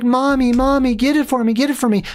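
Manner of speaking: put-on high, pleading child's voice; whiny kid. A kid begging his mom for a burger, high and whiny. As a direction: beg like a kid mid-tantrum. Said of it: "He's like".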